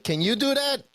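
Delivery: very high mock challenge; imitating Italian customer. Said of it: "and then he's like".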